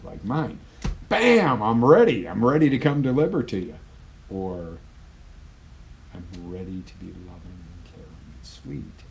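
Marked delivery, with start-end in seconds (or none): 1.1-3.7 much higher and louder, punchy burst of readiness; power anchor. Shown as a demonstration. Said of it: "You see the physiology there". 6.1-9.0 much softer, gentle and loving; tender anchor. Shown as a demonstration. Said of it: "might be a different anchor".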